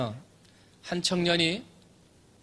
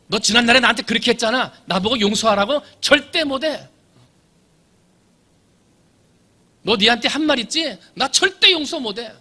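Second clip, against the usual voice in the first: bitter refusal; 7 semitones higher, 7 dB louder than usual. Acted. Acting out an unforgiving spouse.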